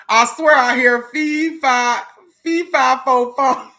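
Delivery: high, loud, giggly self-mockery; laughing mock chant; mocking herself. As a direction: poke fun at your own words. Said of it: "I was making fun of myself".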